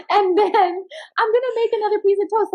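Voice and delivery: high, light sing-song self-talk; playful high inner voice. Retold or imitated. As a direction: chirp it brightly, laughing through. Acted self-acceptance, high voice.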